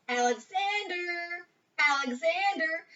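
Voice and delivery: slow drawn-out call for a lost friend. A narrator's reading. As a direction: stretch the name out, calling. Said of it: "calling his name".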